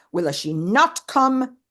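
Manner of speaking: slow fretful repeat, come stressed; tearful and angry. Retold or imitated. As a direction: drag the question out in anguish. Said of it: "Tearfully, but also angrily".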